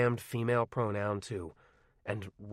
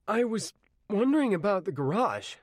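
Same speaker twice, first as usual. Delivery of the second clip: put-on higher, louder nervous teen voice; hesitant request; 11 semitones higher, 4 dB louder than usual. Arnie finally asks the old man about the garage, nervous and high. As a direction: ask it haltingly, working up courage. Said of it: "he said finally".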